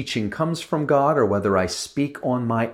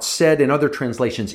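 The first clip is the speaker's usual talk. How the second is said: ordinary delivery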